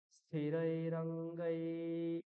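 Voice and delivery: স্থিরৈ রঙ্গৈ slowly, the ai vowels fully sounded; slow, clear. Shown as a demonstration. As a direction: stretch স্থিরৈ রঙ্গৈ out for the class. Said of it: "এটা স্পষ্ট আসতে হবে".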